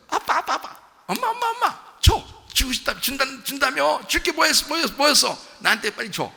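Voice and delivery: put-on high-pitched, eager child pestering a parent; gleefully begging child. Child begging dad and mum for the promised gift, gleefully insistent. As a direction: nag excitedly, high, like a little kid. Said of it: "웃으면서 막".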